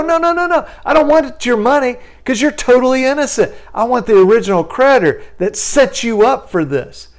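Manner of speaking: attorney teasing the collector; mock reassuring. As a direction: wave off the money playfully.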